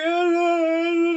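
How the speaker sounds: high mock scream of a panicking brother; panicked scream. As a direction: squeal in disgusted panic.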